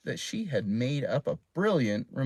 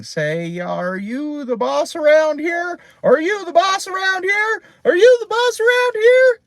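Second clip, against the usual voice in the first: rising, louder and higher each time; yelling parrot; 12 dB louder than usual. A narrator's reading. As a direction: squawk louder with every repeat. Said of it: "blinking and yelling".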